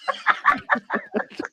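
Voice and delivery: a high falling laugh; real amused laugh. Real, not acted. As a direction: laugh hard at the joke. Laughs at superchat.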